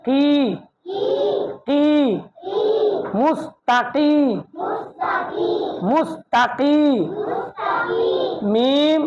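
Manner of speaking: drawn-out slow মুস্তাকিমা; slow drill. Shown as a demonstration. Teacher drilling the word slowly for students. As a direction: spell it out slowly for the class. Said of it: "slow drill".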